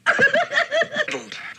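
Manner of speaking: giddy villain cackle, very high. Acted. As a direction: laugh like a delighted madman. Villain laughs after joke.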